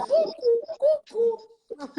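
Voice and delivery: high cuckoo bird call. Retold or imitated. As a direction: imitate a cuckoo clock. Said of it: "salía y decía".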